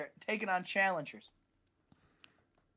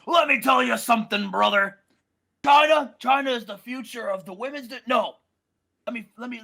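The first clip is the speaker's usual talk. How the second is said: loud hammed-up wrestler promo, brother; lightly voiced Hogan, loud and punchy; Hulk Hogan; 6 dB louder than usual. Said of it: "Hulk Hogan's music hit".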